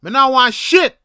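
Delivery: loud, high retold angry shout; snapped outburst. Tired walker snapping at the vendor, re-enacted angry shout. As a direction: snap back at a heckler, full volume. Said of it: "and I snapped. I'm like".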